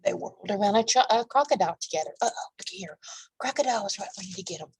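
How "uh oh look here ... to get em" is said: whispered aside. Real, not acted.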